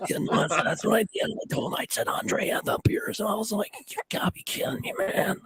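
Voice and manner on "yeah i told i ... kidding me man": hoarse whispered rasp; raspy whisper. Retold or imitated. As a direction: rasp it like Jake the Snake. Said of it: "he talks like this".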